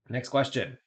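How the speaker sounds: flat machine-like announcement; robot voice. Acted. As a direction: say it like a robot host. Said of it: "hate the robot voice thing".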